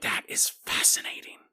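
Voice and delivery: hushed whisper, full of wonder; whispered awe. Retold or imitated. Host recalls reading a story, whispering in awe. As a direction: breathe it out in secret fascination.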